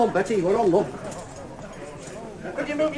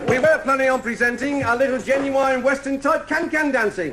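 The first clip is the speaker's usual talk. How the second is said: showman announcer; 5 semitones higher than usual. Acted. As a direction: sell it like a saloon compère. Compère announces the cabaret.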